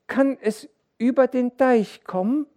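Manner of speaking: sehr hohe, laute Kinderstimme; bange Kinderfrage. A narrator's reading. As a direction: als kleines Kind besorgt fragen. Banges Kind fragt den Vater. (kindlich besorgt)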